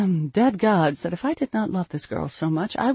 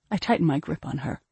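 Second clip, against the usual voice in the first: usual speaking voice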